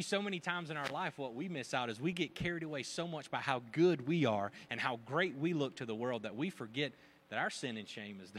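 normal talking voice

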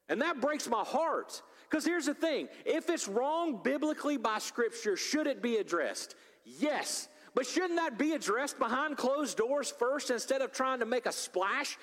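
fervent sermon delivery; impassioned preaching. Acted. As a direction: preach it hard to the congregation. Preaching at full force.

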